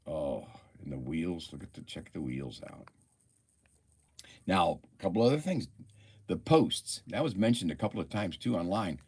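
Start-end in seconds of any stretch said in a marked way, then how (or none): none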